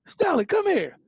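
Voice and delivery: high, loud call across the house; aunt calling her niece over, high and loud. Retold or imitated. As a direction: summon her like an angry aunt. Aunt calls cousin over.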